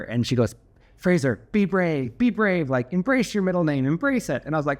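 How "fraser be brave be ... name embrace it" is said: high-pitched imitation of an encouraging mother; mock maternal pep talk, pitched up; encouraging mom. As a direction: urge him on in a raised motherly voice. Mom encourages son on phone.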